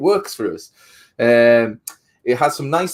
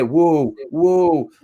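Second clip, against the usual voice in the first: mock soothing whoa; 5 semitones higher than usual. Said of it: "approach said horse with caution and say".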